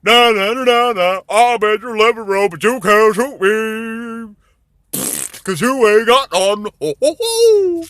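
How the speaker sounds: high, loud sing-song gloat; mock him like a smug cartoon deer; taunting deer. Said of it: "And the deer says".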